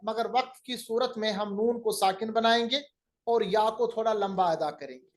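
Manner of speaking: ordinary talk, nothing marked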